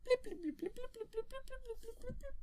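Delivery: very high, piping droid bleeps; squeaky electronic babble; R2-D2 robot beeps. Acted. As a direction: imitate a little robot chirping in quick beeps. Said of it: "R two D two goes in and says".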